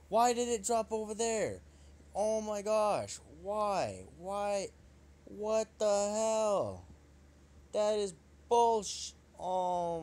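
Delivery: high, loud, drawn-out outrage; outraged yelling. Real, not acted. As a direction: scream in disbelief. Outraged at misplaced drop.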